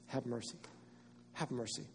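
soft, quiet begging for mercy; hushed pleading. Acted. Blind man begging Jesus softly for mercy. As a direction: plead it under the breath.

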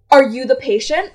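loud, brusque mock question; borderline screaming. Worker questioning the patient's daughter, borderline screaming. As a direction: imitate someone snapping a question loudly. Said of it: "borderline screaming at me".

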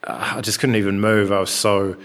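normal talking voice

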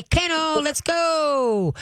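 high, bright command to the crate; brisk kennel command. Shown as a demonstration. Trainer sending a wild pup to its kennel. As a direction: chirp it out cheerfully but firm. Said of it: "Time out".

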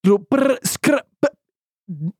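half-remembered hero line; Phoenix impression. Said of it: "I'll do Phoenix first".

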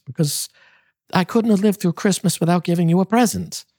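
begging him to forgive her, voice soaring high; cried out pleading. A narrator's reading. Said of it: "she cried".

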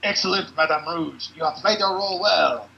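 loud high gloating villain. Acted. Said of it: "And he says".

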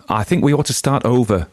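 usual speaking voice

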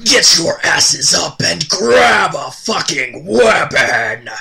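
slow, loud barking sergeant shout; drill sergeant shout. Acted. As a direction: scream orders at the recruits. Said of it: "Shouts Drill Sergeant Nasty".

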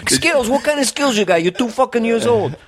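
high-pitched, exasperated disbelief; incredulous dad. Acted. Father questioning his two-year-old, high incredulous voice. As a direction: challenge it, voice shooting way up.

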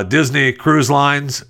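ordinary delivery

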